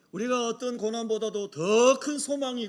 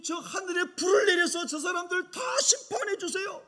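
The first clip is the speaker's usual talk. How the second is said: higher-pitched, heated retold plea; hot-tempered demand; 5 semitones higher than usual. John asks Jesus to judge those people, hot-tempered demand. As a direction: demand judgment in acted indignation. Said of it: "이렇게 혈기가 막 솟아올랐던 나를".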